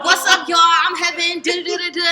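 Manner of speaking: high, loud on-mic hype; re-enacted big on-air hello; radio host intro. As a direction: launch the show at full energy. Her first on-air intro, acted.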